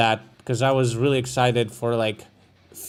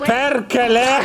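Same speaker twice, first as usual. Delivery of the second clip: shouting; 8 semitones higher, 4 dB louder, slower than usual. Retold or imitated. Echoing Finnish curse clip.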